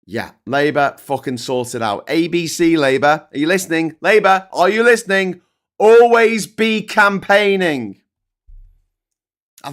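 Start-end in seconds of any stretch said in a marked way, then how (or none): 2.1-5.4 loud, high rallying cry at Labour; rallying shout. Real, not acted. Calling out to Labour, loud rallying shout. 5.8-8.0 "Always be campaigning" shouted high and slow, stress on "Always"; shouted slogan. Real, not acted. Urging Labour to campaign, slow shouted slogan.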